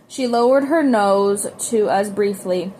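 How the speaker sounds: plain reading voice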